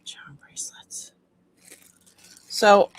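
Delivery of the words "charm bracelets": soft whispered aside. Real, not acted. Reading a label to herself, whispered. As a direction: whisper it to yourself.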